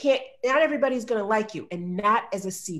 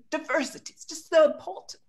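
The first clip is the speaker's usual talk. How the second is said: higher-pitched imitation of a British man; British accent; 7 semitones higher than usual. British colleague going on about diversity, high imitated British accent.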